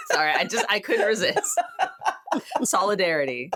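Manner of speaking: ordinary talk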